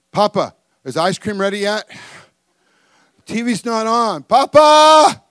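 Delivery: imitating kids calling Papa, rising to a loud yell; whining boys. Boys nag their Papa about ice cream and TV, whining. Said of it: "this is what my boys do".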